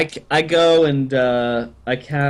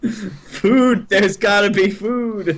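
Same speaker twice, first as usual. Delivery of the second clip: excited; 6 semitones higher, much slower than usual. Acted. Character excitedly misreads the sound.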